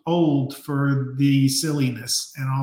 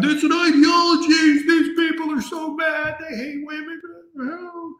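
high-pitched caricature of whining critics; mock outraged wail; 11 semitones higher than usual. Host mocking the other side's complaints, high mock wail.

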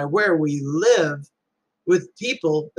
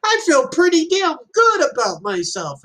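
voicing son; 10 semitones higher than usual. Son answers his parent about feeling good, in a high imitated voice. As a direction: pitch it up as the proud kid. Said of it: "And he goes".

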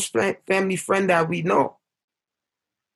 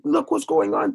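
retold yell; 10 semitones higher than usual. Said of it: "I'm yelling".

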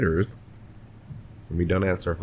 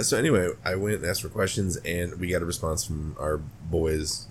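ordinary talk

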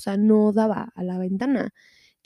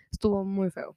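softer, hushed spooky whisper; whispering the eerie ending; 6 dB quieter than usual. Real, not acted. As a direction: drop to a creepy whisper. Closing the ghost story hushed.

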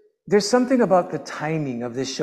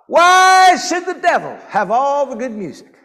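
high, loud mock-outraged shout; exclaimed revelation; 7 semitones higher, 5 dB louder than usual. Preacher delivers the singer's revelation from Jesus to the crowd, shouted out. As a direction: cry it out as a grand revelation.

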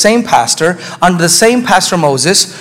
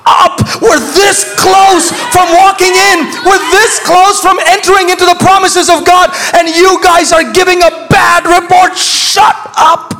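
angry shout; 10 semitones higher, slower than usual. Retold or imitated. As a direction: shout them down in frustration. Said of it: "They were like".

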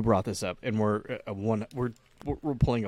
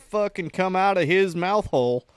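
mock Southern; 6 semitones higher, 5 dB louder than usual. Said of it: "why do we talk like that".

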